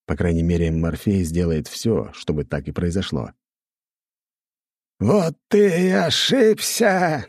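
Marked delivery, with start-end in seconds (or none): 5.0-7.2 high, slow, cackling villain; hysterical laughter. A narrator's reading. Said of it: "истерически захохотал Аватар".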